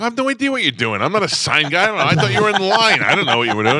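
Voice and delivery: high, loud exasperated protest; retold indignant protest. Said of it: "I go".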